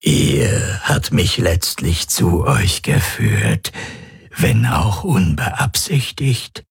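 slow, rasping creature voice; rough, voiceless, drawn-out menace; menacing alien predator. Acted. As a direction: savour each word as a grinning alien hunter.

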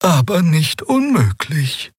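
high, slow murmured line; gemurmelt. A narrator's reading. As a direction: murmur it thoughtfully. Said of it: "murmelte Xea". (Xea murmelt zu Keru: hoch und leise)

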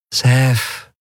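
a touch of sugary sweetness; sugar-sweet. A narrator's reading. Woman warning Sef, sugar-sweet. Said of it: "sagte sie zuckersüß".

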